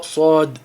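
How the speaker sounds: sad dit grave, bouche gonflée; sad emphatique. Shown as a demonstration. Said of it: "tu gonfles la bouche quand tu le prononces". (sad emphatique, bouche gonflée)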